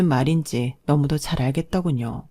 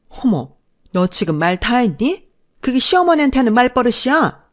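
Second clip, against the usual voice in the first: affronted rebuke, rising higher at the end; outraged mother-in-law; 8 semitones higher, 5 dB louder than usual. Acted. Mother-in-law rebuking daughter-in-law's backtalk, outraged. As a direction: pull rank on her.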